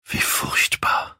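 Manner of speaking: slow, horrified whisper. A narrator's reading. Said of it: "flüsterte er".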